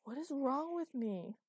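loud, slow, high lament; dramatic wail. A narrator's reading. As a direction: wail it out melodramatically. Dramatic despair on floor.

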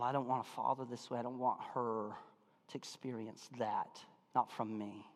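soft, low, sober confession; subdued, remorseful storytelling; hushed regret. Real, not acted. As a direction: drop your voice low and soft. Moved, confessing his regret to congregation.

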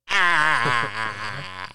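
loud, long broken wail; mock sob held out in pulses; re-enacted cry, loud and choked; imitated crying. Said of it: "you heard the one time I cried".

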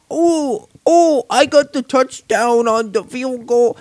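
very high, slow dopey voice; mocking imitation. Imitating someone making fun of football, high mocking voice. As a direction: stretch it out in a silly high mock voice. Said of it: "they start to make fun of it".

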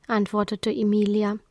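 ordinary delivery

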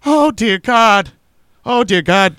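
slow high loud mock despair; mock horror. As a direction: drag it out like doom just arrived. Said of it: "And I was like".

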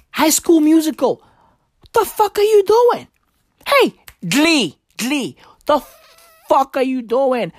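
a disgusted father who can't believe his son; outraged dad. Retold or imitated. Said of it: "And Kim Jong-il was like".

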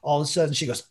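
ordinary talk

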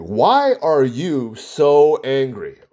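drawn out slowly, word by word; slow probing question. Retold or imitated. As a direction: ask it slowly like a probing therapist. Psychologist asking patient, repeated.

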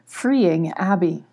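usual speaking voice

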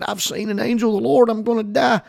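high, panicky mock fear; frightened Gideon. Preacher voicing a terrified Gideon, high and frightened. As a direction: pitch it up like a scared man. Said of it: "Now Gideon's afraid".

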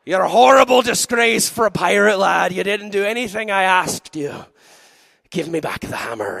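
scolding Irish brogue, swelling to a shout; mean old Irish captain. Acted. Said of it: "and she goes".

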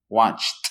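"Watched" as one syllable ending in a crisp t sound; correct English pronunciation. Shown as a demonstration. Teacher modeling "Watched" for Spanish speakers with a clear final t. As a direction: say "Watched" with the tongue released on a final t, no extra vowel. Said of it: "es esa T al final".

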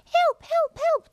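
very high piping help calls; starfish. Acted. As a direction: squeak for help like a tiny starfish. Mother voices starfish.

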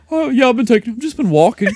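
high, loud mocking quote; clueless gym buddy. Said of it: "and he's like".

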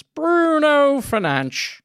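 high, slow, drawn-out commentator call; imitating commentator. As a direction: stretch the name like the game's announcer. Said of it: "I hate the way he says his name".

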